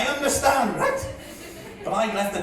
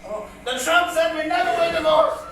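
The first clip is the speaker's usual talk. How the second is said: projected, singsong ballad telling; comic poem recitation; 6 semitones higher than usual. Acted. Comedian reciting his dad's old bit as a tribute, declaimed comic verse. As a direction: declaim the rhyme to a crowd.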